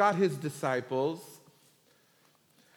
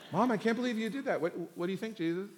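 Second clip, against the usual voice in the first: hurried, higher-pitched mock protest to Mom; embarrassed son; 7 semitones higher, faster than usual. Son protesting to mom before Jesus, embarrassed. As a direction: play a mildly mortified kid, rushing.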